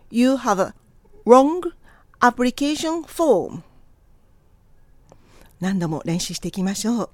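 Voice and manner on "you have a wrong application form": slow, separated chunks with 'wrong' punched louder; repeat-after-me model. Shown as a demonstration. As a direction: pause between phrases for learners. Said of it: "Repeat after me". (区切ってゆっくり、手本として)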